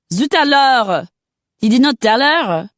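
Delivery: a scandalised Parisian exclaiming; French outrage. Acted.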